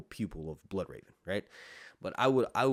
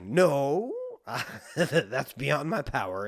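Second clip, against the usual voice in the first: mock Bloodraven refusal; 6 semitones higher, 7 dB louder, much slower than usual.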